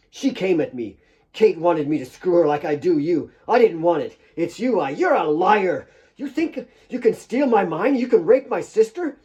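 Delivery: lying then raging. Acted. Nick lying to Claire, building to a loud furious accusation. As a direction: build from excuse to rage.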